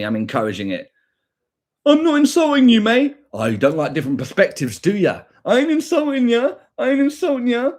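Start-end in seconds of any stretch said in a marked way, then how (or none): 1.9-3.2 mock commenter voice. Mocking an angry commenter. 5.4-7.7 high sneering parody of the commenter; mocking commenter. Streamer mocking a commenter in chat, high mocking parody.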